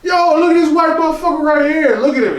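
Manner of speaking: very high, loud playful call-out; imitating a boisterous, high-pitched teasing shout; excited heckling. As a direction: hoot it out to point someone out to the crowd.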